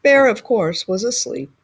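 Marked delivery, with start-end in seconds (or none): none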